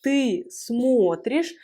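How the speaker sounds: смотришь stressed on a long о; slowly, leaning on the first vowel of смотришь; shifted stress. Shown as a demonstration. Teacher showing the accent moving, смотришь stressed on о. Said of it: "l'accent tonique se déplace".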